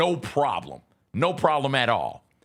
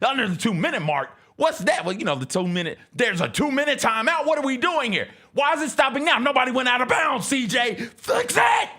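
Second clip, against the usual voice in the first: high, loud outraged complaining; mock furious rant; 11 semitones higher, 3 dB louder than usual. Wife ranting at husband about the clock, loud and high. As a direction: rant at him to fix it.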